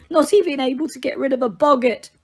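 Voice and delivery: high, stammering, tearful voice; barely holding it together. A narrator's reading. Mrs Weasley weeps into Lupin's shoulder, high and stammering.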